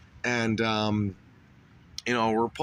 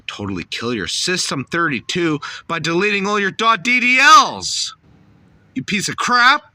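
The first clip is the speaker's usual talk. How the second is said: much higher and louder, screaming threats; furious shout; 10 semitones higher, 8 dB louder than usual. Acted. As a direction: explode at the victim in a rage. Hacker persona erupts into rage.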